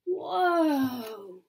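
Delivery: gasp, then long loud whoa; awestruck. Acted. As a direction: marvel at a sudden discovery. Amazed at discovery.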